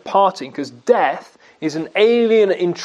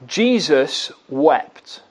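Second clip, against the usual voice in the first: very slow, weighty delivery; solemn and slow; 5 semitones lower, much slower than usual. Real, not acted. Preacher lingers on the verse at Lazarus' tomb, slow. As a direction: let each word land heavily.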